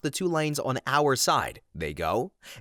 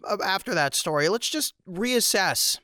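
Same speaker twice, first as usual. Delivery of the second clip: collecting himself; 6 semitones higher than usual. Real, not acted. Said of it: "take a breather".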